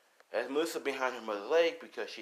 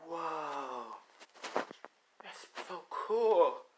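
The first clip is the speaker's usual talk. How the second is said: put-on mild drawn-out wonder in a child's part; awed child; 4 semitones higher, much slower than usual. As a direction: let the amazement stretch the words slowly. Child awed by hidden island.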